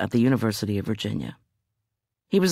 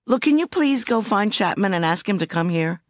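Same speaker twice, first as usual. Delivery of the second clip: a lightly voiced, higher indignant Cooper; a hint of bristling prosecutor, pitched up; Cooper protesting; 10 semitones higher than usual. Cooper protesting to the officer, lightly voiced and indignant.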